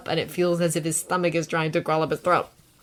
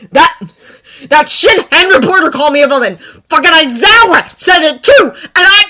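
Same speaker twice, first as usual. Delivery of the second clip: angry; 10 semitones higher, 16 dB louder than usual. A narrator's reading. Bakugo near tears.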